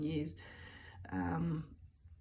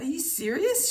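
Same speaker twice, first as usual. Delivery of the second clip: louder and higher, in disbelief; incredulous; 9 semitones higher, 7 dB louder than usual. Retold or imitated. To her friend about the lost necklace, amazed and louder. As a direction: fire the question back, amazed.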